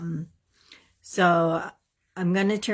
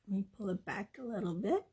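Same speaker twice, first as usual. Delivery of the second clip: whispering; 2 semitones higher, 11 dB quieter, much faster than usual. Real, not acted. Unvoiced aside while handling camera.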